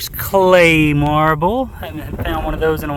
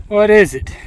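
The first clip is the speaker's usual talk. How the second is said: more eager, higher and louder; excited question, higher; 6 semitones higher, 4 dB louder than usual. Real, not acted. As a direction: ask it with rising excitement. Unearths unknown find.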